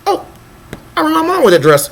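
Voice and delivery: high, airy mock thought voice; a scatterbrained head voice; flighty inner voice. Voicing her jumpy thoughts at lunch, high mock voice.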